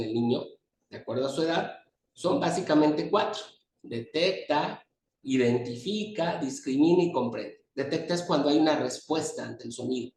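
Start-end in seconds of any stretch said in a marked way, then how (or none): 3.8-7.6 each word drawn out slowly as a list. Shown as a demonstration. Teacher listing skills for students, slowly. Listing the four hearing abilities.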